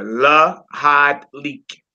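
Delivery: Lahad Leak drawn out slowly, la-HAD leek; slow pronunciation demo. Shown as a demonstration.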